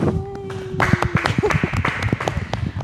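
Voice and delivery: high laugh; bright laugh. Real, not acted. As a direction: burst out laughing. Host laughs at outro.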